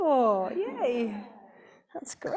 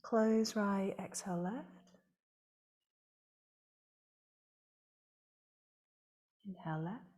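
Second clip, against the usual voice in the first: calm, measured yoga teacher voice, softer than chat; guided meditation; 4 semitones lower, 8 dB quieter than usual. Real, not acted. Yoga teacher leading the class through alternate nostril breathing, calm guided meditation. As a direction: guide the breathing with long pauses between phrases.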